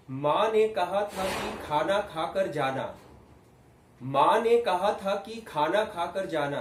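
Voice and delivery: slow, loud, high projected line. Shown as a demonstration. Teacher building volume in a drill, loud slow projection. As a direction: project firmly without shouting, each word stretched. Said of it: "थोड़ा और बढ़ाइए".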